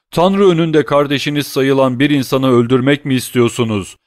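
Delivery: loud desperate begging; desperate plea. A narrator's reading. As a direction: beg for your life from your knees. Starving prisoner begs captors.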